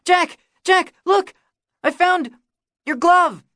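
put-on high, loud, excited little-boy cry; eager boy bursting with news; breathless kid. A narrator's reading. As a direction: gasp it out like a kid who ran all the way. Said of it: "Kiki is gasping".

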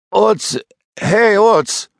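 high, slow croaked call; panicked frantic pleading. Acted. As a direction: try to shout, only a croak comes out. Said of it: "nur ein Krächzen kam". (verzweifelt krächzender Ruf)

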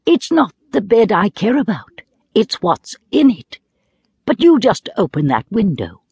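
a hint of an earnest little boy. Diamond voiced as child.

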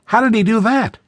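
put-on high, eager child's question; ask, hooked by the story; curious boy. A narrator's reading. Boy eagerly asking about the giant story, high. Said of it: "asked Thorley".

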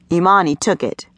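usual speaking voice

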